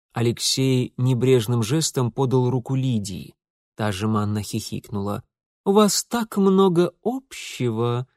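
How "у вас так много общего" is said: slow, drawn-out mockery; mocking drawl. A narrator's reading. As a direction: drawl it with a sneer. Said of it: "протянул он".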